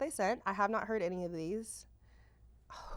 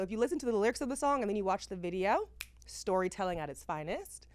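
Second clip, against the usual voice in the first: ordinary delivery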